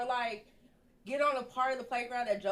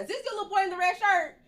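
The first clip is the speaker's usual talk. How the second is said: loud, high question to a stranger; calling out; 9 semitones higher, 8 dB louder than usual. Retold or imitated. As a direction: call it across the playground, retold. Calling to a stranger about child.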